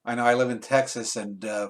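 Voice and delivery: usual speaking voice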